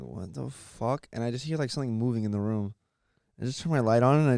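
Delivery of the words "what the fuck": soft, very slow disbelief; groggy bafflement. Retold or imitated. Sleeper hearing the noise once more, slow hushed bafflement. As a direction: stretch it out under your breath. Keeps hearing it while half asleep.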